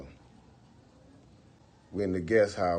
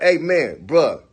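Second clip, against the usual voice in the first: louder, higher, fed up; angry; 5 semitones higher, 9 dB louder than usual. Retold or imitated. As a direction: snap at him to stop. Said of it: "So I'm like".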